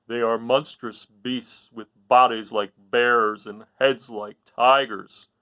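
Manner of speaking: a big beast describing monsters, slowly; lion voice. Acted. The lion tells Dorothy about the Kalidas, slow lion voice. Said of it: "replied the lion".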